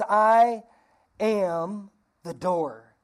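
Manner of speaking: I held, then am the door drawn out very slowly; slow emphatic quote. Shown as a demonstration. Pastor quoting Jesus to the church, slow stress. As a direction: lean on each word as Jesus' claim. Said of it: "And he says".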